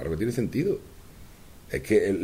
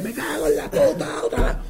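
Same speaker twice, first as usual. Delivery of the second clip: mock cursing; 8 semitones higher than usual. Angry taxpayer paying the tax office again. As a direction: grumble like you're paying again. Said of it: "pero si tú vas".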